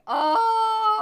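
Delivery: high, loud, drawn-out "oh"; excited squeal. Real, not acted. Streamer to viewer about colorful hair, delighted squeal. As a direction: squeal with delight for someone else.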